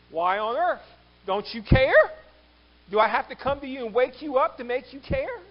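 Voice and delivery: loud, high, exasperated questioning; indignant plea. Acted. Disciple reproaching sleeping Jesus, indignant plea.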